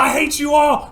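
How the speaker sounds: high, loud outburst; hammed-up rampage yell; raging shout. Pip raging at the audience, shouting.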